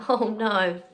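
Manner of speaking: plain reading voice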